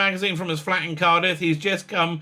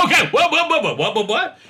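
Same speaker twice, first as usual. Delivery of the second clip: laughing disbelief; 8 semitones higher, 4 dB louder than usual. Real, not acted.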